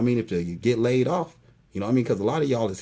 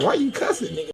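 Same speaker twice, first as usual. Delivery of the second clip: mocking the critics; 12 semitones higher than usual. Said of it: "people be like".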